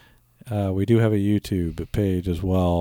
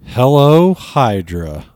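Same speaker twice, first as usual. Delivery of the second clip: slow and drawn out; 3 semitones higher, 9 dB louder, much slower than usual. Retold or imitated. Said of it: "What if it's like".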